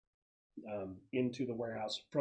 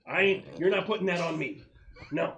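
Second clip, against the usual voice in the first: indignant refusal; 6 semitones higher, 9 dB louder than usual. Acted. Bad Vibes refuses responsibility.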